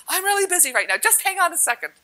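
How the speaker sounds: high, loud, too busy to stop; harried brush-off. Retold or imitated. Retelling to the woman offering a vial, high harried brush-off. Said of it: "And I'm like".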